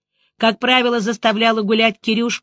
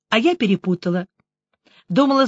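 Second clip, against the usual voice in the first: ordinary delivery, nothing marked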